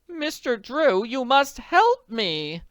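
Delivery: anxious wealthy woman pleading; pleading society lady. Acted.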